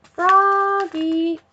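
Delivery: high, loud yell of a name. Acted. Dad yelling at Froggy in the last minute, loud. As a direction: yell it across the field. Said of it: "yelled his dad".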